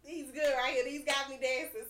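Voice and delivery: normal talking voice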